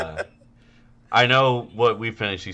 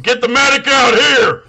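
high, loud imitation bellow; mock host shout; 12 semitones higher, 10 dB louder than usual. Podcaster as the host summoning a medic, hammed-up shout.

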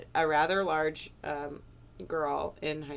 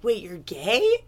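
slow, loud, higher shocked question; mock surprise; 5 semitones higher, 8 dB louder, slower than usual. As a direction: act floored by the news. Shocked reply at Starbucks.

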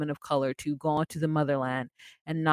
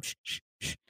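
repeated insistent shushes; soft string of mock shushes; imitated shushing; 12 dB quieter, faster than usual. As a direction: hush the car over and over. Imitates caller shushing the car.

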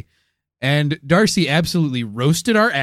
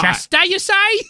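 higher, hammy mock British accent. Questioning Darcy's hometown, mock accent. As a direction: ask it in a put-on posh accent. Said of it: "these horrible accents".

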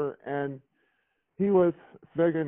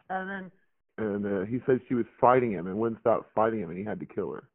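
ordinary talk, nothing marked

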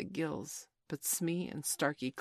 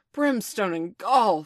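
very loud, slow, raging roar; thundering; 5 semitones higher, 12 dB louder, slower than usual. A narrator's reading. Hook enraged at his crew, thundering. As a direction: thunder it out in a rage. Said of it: "thundered Hook".

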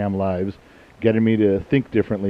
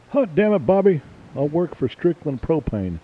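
Texan cartoon dad; 7 semitones higher than usual. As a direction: play a proud propane-selling Texan dad. Mock Texan dad catchphrase.

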